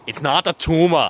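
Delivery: quoting Arnold to a friend, hammed-up; mock Arnold. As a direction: punch out a hammed-up Arnold impression. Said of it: "I just go".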